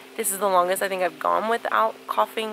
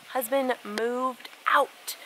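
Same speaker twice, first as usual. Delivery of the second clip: dramatic slow; 3 semitones higher, 4 dB quieter, much slower than usual. Real, not acted. Dramatic repeat of the news.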